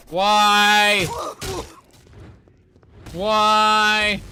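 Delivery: loud high exasperated cry; exasperated why. Real, not acted. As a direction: plead at the game in frustration. Frustrated by stagger.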